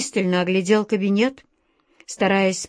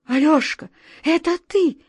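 much higher, urgent relief; almost shouting; 8 semitones higher than usual. A narrator's reading. The scared director recognising his friend on the phone, almost shouting. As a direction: nearly cry out in relief. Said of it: "почти закричал Владимир Владимирович".

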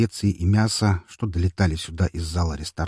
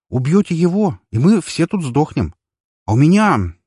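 urgent plea; 8 semitones higher, 6 dB louder than usual. Acted. Heated plea to the boss.

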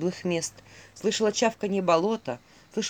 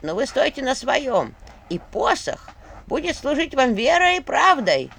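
голос старухи Егини; 4 dB louder than usual. A narrator's reading. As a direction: preach the old rules like a crone. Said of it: "слышался голос памяти". (назидательный старушечий голос)

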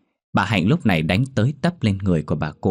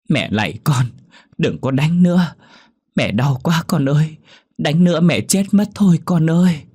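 high, loud desperate crying plea; pained begging; 9 semitones higher, 5 dB louder than usual. Acted. Old mother begging her daughter to stop beating, high and wailing. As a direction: wail for mercy through the pain. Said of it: "ú ớ van xin trong đau đớn".

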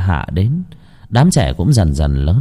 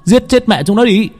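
high, furious battle cry; rally the villagers to kill the dogs; angry shout; 11 semitones higher than usual. A narrator's reading. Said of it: "Tiếng của thằng Bẻo vang lên".

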